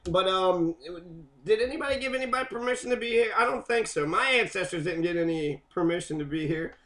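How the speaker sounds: normal talking voice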